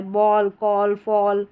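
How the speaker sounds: "बॉल, कॉल, फॉल" each with long aw; word-list demo of "बॉल, कॉल, फॉल" with long vowels; long aw pronunciation. Shown as a demonstration. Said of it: "long pronunciation होता है".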